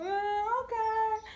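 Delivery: high, light and falsely sorry; sheepish mock apology. Brushing off the lady about her aunt, mock-sorry and high. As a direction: act innocently apologetic, pitched well up. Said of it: "And I'm just like".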